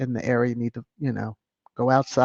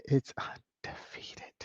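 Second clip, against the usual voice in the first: whispered, much softer, slow; 13 dB quieter, slower than usual. Shown as a demonstration. Streamer whispering the punchline to the viewers. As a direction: lean in and whisper it.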